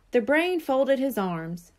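ordinary delivery, nothing marked

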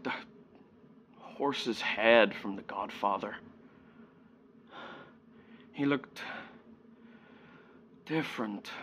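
hushed, haunted recollection with long pauses; subdued grief, trailing into near silence; sombre monologue. Acted. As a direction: confess softly, leaving heavy silences. Monologue ends on grief and guilt.

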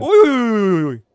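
high startled cry; startled yelp. Real, not acted. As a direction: jump at a sudden scare. Startled by the ghost.

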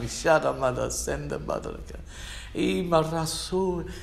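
chanted heavenly tongue; speaking in tongues. Shown as a demonstration. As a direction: let the tongue flow freely.

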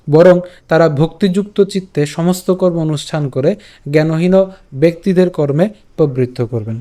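normal talking voice

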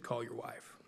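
very soft champ impression; Muhammad Ali. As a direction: lightly imitate the boxer, hushed. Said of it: "gonna do my Muhammad Ali impression".

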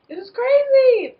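high, loud and slow; excited. Real, not acted. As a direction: wail it out in disbelief.

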